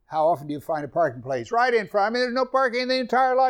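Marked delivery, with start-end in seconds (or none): 1.5-3.5 high, fast excited telling. Retold or imitated. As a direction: marvel at the luck. Excited parking miracle.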